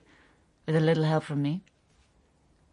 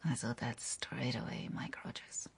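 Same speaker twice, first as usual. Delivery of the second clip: soft, breathy, much softer whisper; intimate whisper; 7 semitones lower, 11 dB quieter than usual. Acted. Greta whispering fondly to Mike about their first meeting. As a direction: lean in and breathe his name.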